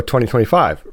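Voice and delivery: normal talking voice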